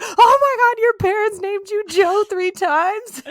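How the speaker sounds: high, loud gasping disbelief; hammed-up scandalised outburst; mock shock. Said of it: "the shock of the century when people were like".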